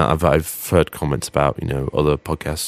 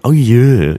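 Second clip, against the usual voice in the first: loud, higher-pitched sarcastic retort; 6 semitones higher, 7 dB louder than usual. Acted. Imitating a sitcom character who can't sound normal, sarcastic. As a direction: drip with exaggerated sarcasm. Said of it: "came out like a really sarcastic".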